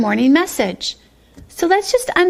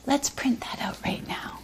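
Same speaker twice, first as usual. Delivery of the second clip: hushed whisper; soft whispered aside; 12 dB quieter than usual. Shown as a demonstration. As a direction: whisper it to the kids. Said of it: "whispering to the class".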